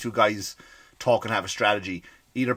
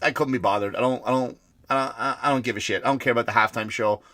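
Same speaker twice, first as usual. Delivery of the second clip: ordinary delivery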